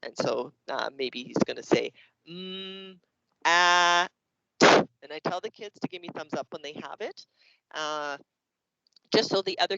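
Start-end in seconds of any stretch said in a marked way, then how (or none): 2.3-4.7 held "Mm", pause, held "ah", clean short "t-"; separate sounds. Shown as a demonstration. Turtle saying only the sounds of a word, slow and segmented. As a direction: sound out "Mm, ah, t-" one by one. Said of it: "only gonna say the sounds".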